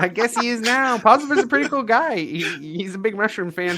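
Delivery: quick, bright, high gnome chatter; chirpy gnome tinkerer, talking fast; fast chirpy excited tinkerer. Acted. GM voicing the gnome Posiver.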